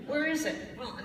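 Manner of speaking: usual speaking voice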